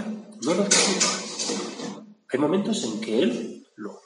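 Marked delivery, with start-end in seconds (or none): 0.0-2.0 slow, breathy half-whisper repeat; slow hushed echo. Real, not acted. Preacher echoing his point to listeners, slow and hushed. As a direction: let it sink in, drawn out and hushed. Echoing the same words softly.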